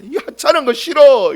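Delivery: put-on high, loud childish whine; whiny refusal. Grumbling people rejecting manna, whiny. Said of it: "이야기하는 거예요".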